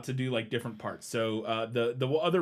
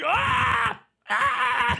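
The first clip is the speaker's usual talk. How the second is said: short hammed-up yelps; mock scream; 9 semitones higher, 5 dB louder than usual. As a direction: yelp twice in mock horror. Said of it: "And I'm like".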